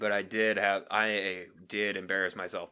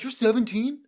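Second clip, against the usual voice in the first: mock surprised coworker; 13 semitones higher, 5 dB louder than usual.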